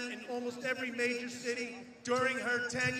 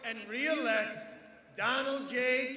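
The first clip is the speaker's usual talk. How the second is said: ordinary delivery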